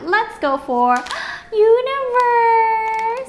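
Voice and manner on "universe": high, slow drawn-out name; excited gasp. Real, not acted. Finding the big toy, a high thrilled drawn-out name. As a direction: gasp and stretch it out.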